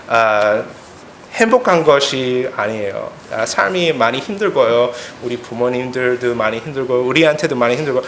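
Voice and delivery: ordinary talk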